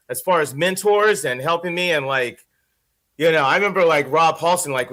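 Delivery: usual speaking voice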